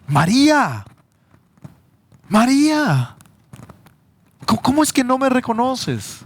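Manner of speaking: high ringing exclamation, name called twice; exclaiming Jesus. Acted. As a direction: cry out María as a risen Jesus would. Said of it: "con signos de admiración, de exclamación".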